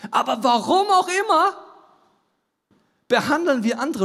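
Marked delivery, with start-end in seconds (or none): 0.1-1.6 high outburst. Real, not acted. Preacher's sudden high outburst.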